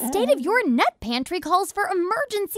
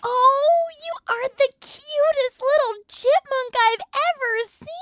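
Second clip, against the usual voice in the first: put-on cooing baby talk; 10 semitones higher than usual. Cooing over tiny chipmunk.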